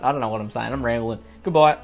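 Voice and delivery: ordinary talk, nothing marked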